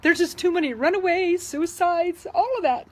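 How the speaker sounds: holding back tears as she goes on; choked up over runaways and suicides; emotional. Real, not acted. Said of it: "I'm sorry, I'm getting emotional".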